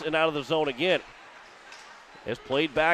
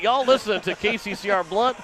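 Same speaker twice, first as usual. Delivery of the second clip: Missouri twang; 5 semitones higher, 3 dB louder than usual. Real, not acted. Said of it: "my Missouri roots are coming out".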